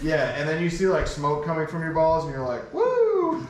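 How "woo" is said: a high, loud laughing whoop; mock excited woo. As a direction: whoop like it's a party. Smoke from his balls.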